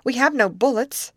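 high, helpless exclamation; blank exclamation. A narrator's reading. Amy to the girls with no plan left, blank exclamation. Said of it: "exclaimed Amy blankly".

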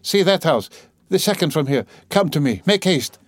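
pressing appeal in short bursts; urgent pleading. Acted. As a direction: hurry them along imploringly. Sonia urgently begging bystanders for help.

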